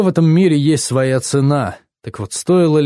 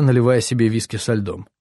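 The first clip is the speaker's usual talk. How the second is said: plain reading voice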